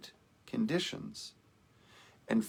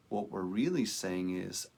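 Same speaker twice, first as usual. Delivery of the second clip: ordinary delivery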